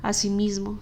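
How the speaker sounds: usual speaking voice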